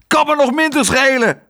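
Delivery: high, loud furious retort; angry shout. A narrator's reading. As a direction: throw it back in a rage. Raging father argues.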